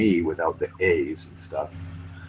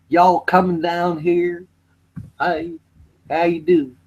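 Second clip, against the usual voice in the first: high, drawn-out Southern drawl; mock hillbilly twang, pitched up; put-on Southern accent; 10 semitones higher, slower than usual. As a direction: stretch it out like a cartoon Southerner. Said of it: "the hillbilly types with no teeth".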